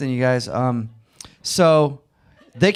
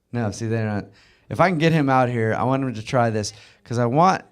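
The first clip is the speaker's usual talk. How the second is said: ordinary talk, nothing marked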